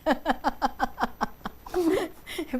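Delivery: long run of quick giggles; amused laughter. Real, not acted. Interviewer tickled by the guest's joke, giggling. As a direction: laugh out in a rapid, bubbling string.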